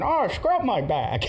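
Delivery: imitated grandfather. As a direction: play a grandpa calling slowly from the bath. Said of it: "the grandfather's going".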